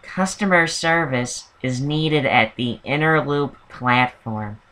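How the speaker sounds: slow, soft imitation of a weary announcer; tired Fran Drescher. Mimicking the annoying transit announcer for listeners, tired Fran Drescher voice. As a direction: drag out a train announcement in a hungover voice. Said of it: "another one you hear from her a lot is".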